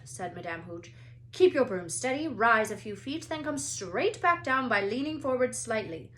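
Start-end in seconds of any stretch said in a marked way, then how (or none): 1.3-6.1 louder teacher orders; instructing loudly. A narrator's reading. Madame Hooch instructs the class, loud. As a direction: project to the class.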